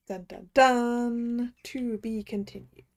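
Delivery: very slow, drawn-out cliffhanger; hammed-up dramatic ending; mock suspense sting.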